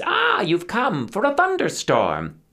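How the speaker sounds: put-on old river witch Mother Kronk demanding a shipwreck; cackling witch. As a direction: play the witch greeting her imps with a cackle. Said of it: "with a cackle like dry lightning".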